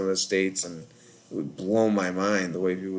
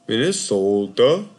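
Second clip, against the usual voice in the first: "Minnesota" louder and slow with a long O, Minnesota accent; mock Minnesota accent; 5 dB louder, slower than usual. Shown as a demonstration. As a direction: stretch "Minnesota" in a hammed-up Minnesota accent, rising at the end. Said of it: "like, that accent".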